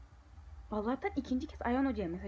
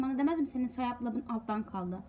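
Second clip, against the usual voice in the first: ordinary talk, nothing marked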